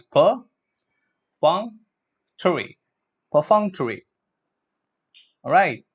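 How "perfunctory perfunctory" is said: "perfunctory" broken into per, FUNK, tuh-ree, then joined; syllable-by-syllable pronunciation. Shown as a demonstration. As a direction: spell out "perfunctory" by sound with pauses. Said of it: "Look at this".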